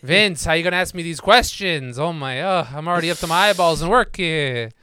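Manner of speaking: loud, exaggerated Yiddish accent. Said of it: "They'll be like".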